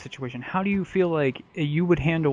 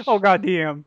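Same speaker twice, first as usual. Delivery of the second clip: imitated friend's exclamation; 5 semitones higher, 3 dB louder than usual. Imitating friend beside the table dance.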